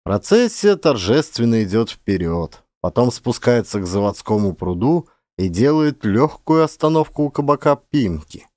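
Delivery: ordinary delivery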